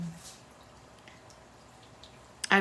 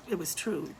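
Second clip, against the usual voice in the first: normal talking voice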